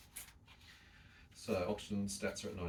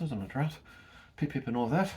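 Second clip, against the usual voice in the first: mock posh British; 7 semitones higher, 6 dB louder than usual. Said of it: "It's very British of us".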